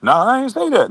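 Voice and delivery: high-pitched imitated protest; mock denial. Man denying what was overheard, high mock denial.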